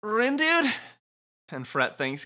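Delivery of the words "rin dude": concerned. Acted. Voicing Fret concerned.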